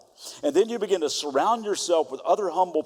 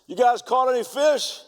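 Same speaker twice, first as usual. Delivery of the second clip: higher, louder hail across the water; calling out; 10 semitones higher, 4 dB louder than usual. Retold or imitated. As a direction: call out from the shore to the boat. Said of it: "shouts out to them".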